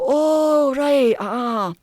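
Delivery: high, drawn-out Highland response. Retold or imitated. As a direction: take it in like an unhurried Highlander. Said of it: "the ones up in the Highlands".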